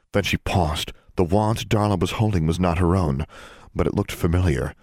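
usual speaking voice

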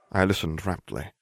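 ordinary delivery

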